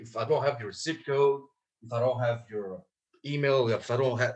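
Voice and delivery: usual speaking voice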